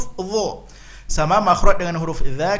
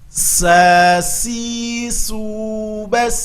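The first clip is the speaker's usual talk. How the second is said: slow Quran recitation drill; 6 semitones higher, much slower than usual. Shown as a demonstration. Teacher leading students in a slow drill. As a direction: chant each syllable slowly and clearly.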